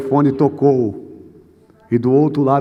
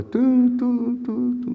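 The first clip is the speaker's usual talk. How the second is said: high, monotone telephone beeps; soft, high-pitched "tum, tum" phone signal; imitated dial tone; 10 semitones higher, 5 dB quieter, much slower than usual. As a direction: mimic the unwanted tone on the line. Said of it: "aquele barulhinho que a gente não quer ouvir".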